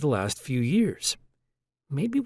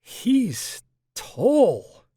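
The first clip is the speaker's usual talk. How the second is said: put-on higher, louder light Russian accent; 11 semitones higher, 5 dB louder than usual. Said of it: "in a light Russian accent".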